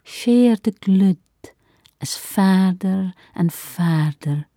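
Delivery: poem read in Shetland dialect; measured Shetland poetry reading; Shetland accent. Acted. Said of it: "in Shetland dialect".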